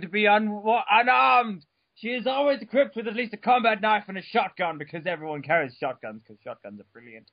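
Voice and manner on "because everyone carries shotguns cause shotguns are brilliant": much lower, much softer throwaway; quiet deadpan aside; mumbled aside. Real, not acted. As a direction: mutter it under your breath. Aside comment on shotguns.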